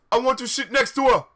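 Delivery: fast, loud, high demand; angry yell. Acted. Draco fighting over a seat next to her, fast yell. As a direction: shout it in one hammed-up rush. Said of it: "yelled Draco at Vampire".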